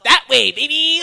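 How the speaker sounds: high, loud hammed-up come-on; creepy recurring voice. Biker propositioning a date at the bar, creepy high loud voice. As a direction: squeal it out as a mock seduction. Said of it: "It's that voice again".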